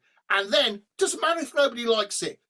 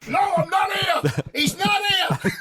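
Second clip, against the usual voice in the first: laughing denial; 9 semitones higher, 4 dB louder than usual. Retold or imitated. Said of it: "you get the phone".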